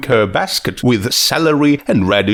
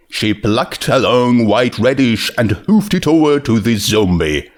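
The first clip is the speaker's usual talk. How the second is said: ordinary delivery, nothing marked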